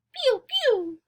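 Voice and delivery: high-pitched little bird cheep; chirping bird. Acted. Teacher voices the story's bird for learners, high chirp. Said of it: "I say it like that".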